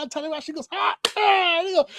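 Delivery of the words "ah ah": repeated high mock yells; imitated wrestler yells. Retelling Tanahashi's reply in the exchange, high mock yells. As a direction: fire back high yells at talking volume.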